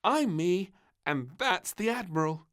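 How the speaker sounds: put-on high child voice announcing. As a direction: proudly present the toy. Princess voice introducing toys.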